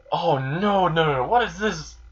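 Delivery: high, wailing run of dismay; hammed-up alarm, pitch swooping up; mock panic. Victim panics over confetti.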